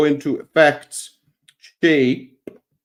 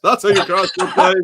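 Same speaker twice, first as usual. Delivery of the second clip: laughing; 4 semitones higher, 4 dB louder than usual. Real, not acted.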